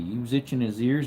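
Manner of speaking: ordinary talk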